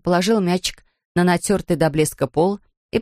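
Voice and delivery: ordinary delivery, nothing marked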